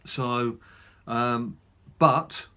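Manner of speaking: ordinary delivery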